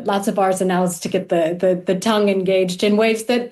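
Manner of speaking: normal talking voice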